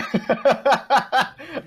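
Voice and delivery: high, loud burst of laughter; real high-pitched cackle of amusement. Real, not acted. Co-host cracking up at the host's abrupt segue, loud high laugh.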